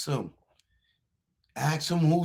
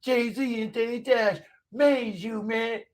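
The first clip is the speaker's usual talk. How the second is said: higher, slow, drawn-out taunt; mocking sing-song; 5 semitones higher, slower than usual. Streamer mocks a heckler, slow high-pitched taunt. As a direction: stretch it out to rub it in.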